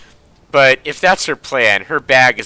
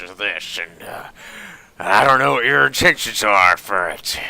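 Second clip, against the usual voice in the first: put-on old German junk dealer, reluctant to sell; hammed-up old tinkerer working up to a price; gruff well-meaning German. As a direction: hem and haw in a gruff put-on accent. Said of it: "He speaks with a gruff but well-meaning tone".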